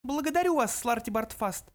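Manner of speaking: high mouse voice, cold dismissal. A narrator's reading. Mouse silences the protesting old man, cold. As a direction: cut him off coldly, up high. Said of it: "холодно отрезал Бенджи".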